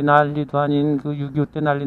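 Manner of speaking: ordinary talk, nothing marked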